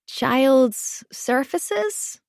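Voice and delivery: put-on high, slow child's question; little boy. A narrator's reading. Boy asking his mother what that is, childlike. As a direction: puzzle out unfamiliar words in a small child's voice. Small boy mispronounces an unknown term.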